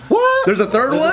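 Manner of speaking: high-pitched, startled disbelief; surprised. Real, not acted. Friend hears of a third sequel, surprised. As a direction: blurt it out, voice jumping up.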